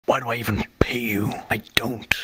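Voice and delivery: normal talking voice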